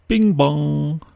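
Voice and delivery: announcer's shout. A narrator's reading. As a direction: call it out like a chime. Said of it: "gannet responsible for shouting".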